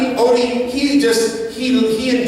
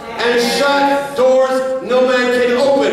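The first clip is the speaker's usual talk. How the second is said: rising preacher's cadence building to a shout; full-force preaching; 4 semitones higher than usual. Acted. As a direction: drive it home to the congregation.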